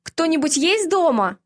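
calling into an empty house. A narrator's reading. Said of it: "позвала".

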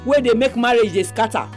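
normal talking voice

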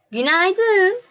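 high greeting, lifting at the end; roses greeting back. A narrator's reading. Roses answer the little prince, high and rising.

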